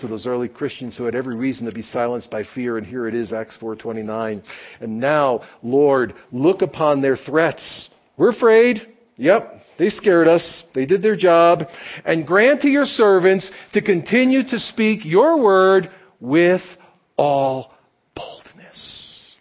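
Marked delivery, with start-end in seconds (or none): 4.8-17.7 loud, much higher, slow, declaimed plea; preacher reading the apostles' prayer with full force; fervent scripture prayer. Acted. Reads Acts prayer aloud. 18.2-19.4 hushed whisper closing the prayer; whispered boldness. Acted. Prayer ends on key word.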